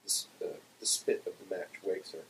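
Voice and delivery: plain reading voice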